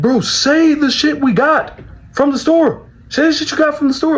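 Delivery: high, strained yell imitating an angry brother; furious demand. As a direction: stretch the first demand, then rattle it off again.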